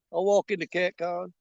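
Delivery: ordinary talk, nothing marked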